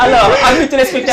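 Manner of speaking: host turns to viewers, still laughing. Real, not acted. Pushing the words out through laughter. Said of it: "Avec ce fou rire-là".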